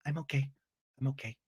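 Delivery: small, soft, downcast reply; mock subdued. Recapper imitates Shannon saying she is okay, subdued and small. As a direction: answer very quietly, as if putting on a brave front.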